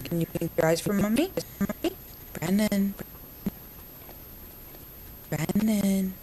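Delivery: ordinary talk, nothing marked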